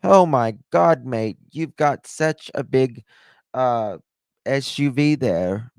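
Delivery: British attendant gawping at a big SUV, mock British accent; British guy. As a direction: ham up an amazed British car attendant. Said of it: "He's like".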